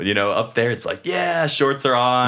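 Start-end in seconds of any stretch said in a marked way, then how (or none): 1.0-2.3 high, loud, drawn-out cheer; excited summer cheer. Acted. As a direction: whoop it slowly like summer just arrived. Acting out summer excitement up north.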